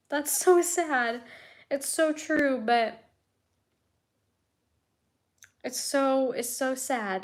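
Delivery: words catching with grief; choked up. Real, not acted. Reading a comforting comment, voice breaking with grief. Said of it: "you're gonna make me cry".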